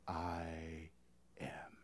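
awed whisper of the divine name. Acted. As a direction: drop to a hushed reveal. Climax of Jesus' claim.

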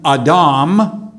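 "Adam" as ah-DAHM, stress on second syllable; Hebrew pronunciation. Shown as a demonstration. Preacher naming the first human from Genesis, "Adam" in Hebrew pronunciation, ah-DAHM. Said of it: "would be pronounced more like".

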